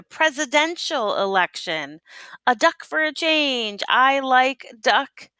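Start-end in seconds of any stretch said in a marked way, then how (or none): 2.5-5.3 higher, punchy campaign slogan; a higher, proud campaign slogan. Acted. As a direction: shout it like a rally chant.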